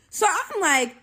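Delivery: plain reading voice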